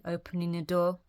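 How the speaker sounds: ordinary delivery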